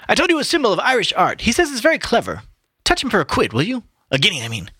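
high-pitched, lively character voice; boisterous Buck Mulligan. Acted. Mulligan cadging money from his friend, high-pitched and boisterous. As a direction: pitch it high, dropping on the afterthought.

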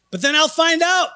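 high, drawn-out comic shout; mock outrage. Husband finds wife watched ahead without him, mock-outraged shout. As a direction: shout it like a hammed-up betrayed husband.

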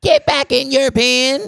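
put-on high, slow, shouted old woman; shouting old woman. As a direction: bellow it like a shrill old woman. Movie quote in character voice.

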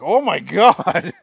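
slow, loud, high-swooping exclamation; shocked exclamation. Retold or imitated. Telling friend of arm at home, shocked exclamation. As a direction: draw it out in horrified shock. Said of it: "I'm like".

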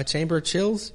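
ordinary talk

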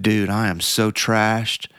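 slow, worn-out confession; exhausted. Retold or imitated. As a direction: drag it out, fully spent. Exhausted runner answers.

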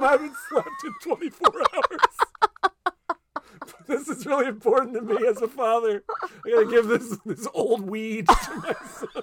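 a desperate, worn-out father hamming it up; cracking up mid-line as the frantic dad; frazzled dad voice. Retold or imitated. Frazzled dad begs, laughing.

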